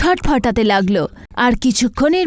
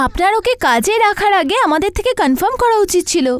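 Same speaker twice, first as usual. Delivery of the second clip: agency woman; 9 semitones higher than usual. Acted. Agency admin voiced by narrator.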